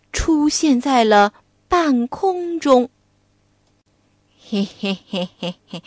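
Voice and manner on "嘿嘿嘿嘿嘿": sly giggle. Acted. Pig demon leering at bride.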